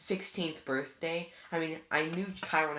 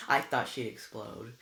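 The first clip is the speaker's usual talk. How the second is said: ordinary delivery, nothing marked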